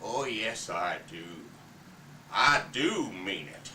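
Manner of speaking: a dying father crushing his wife's takeover, furious; Big Daddy monologue. Acted. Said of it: "Big Daddy comes back".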